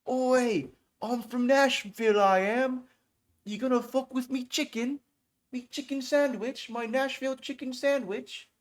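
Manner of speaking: a Nashville guy faking British, hammed-up and high; fake British accent. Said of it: "I'm gonna put on an accent".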